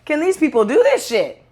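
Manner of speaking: high, heated disbelief, retold; a young self's mock fury at the elites; mock angry outburst. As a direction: fire it off like outraged at learning the truth. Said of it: "Then you got angry".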